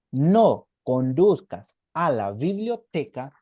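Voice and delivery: spoken slowly and clearly for students to translate; teacher dictating a sentence; slow dictation. Shown as a demonstration. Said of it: "¿cómo se lo diría?".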